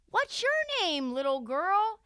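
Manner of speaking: put-on high sing-song kid-directed question; talking to a child. Host asks little girl her name, high-pitched talking-to-kids voice. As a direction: coo it as if to a small child.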